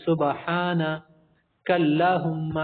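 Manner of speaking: سبحانک اللہم drawn out very slowly, words split apart; wrong split demo. Shown as a demonstration. The teacher imitates the mistaken split. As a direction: separate سبحانک and اللہم with slow pauses. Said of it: "بعض لوگ اس کو الگ کرتے".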